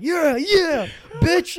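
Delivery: loud, high whoop of victory; triumphant yell. Retold or imitated. Brother re-enacting his punch on sister, triumphant yell. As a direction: burst out in a re-enacted triumphant shout.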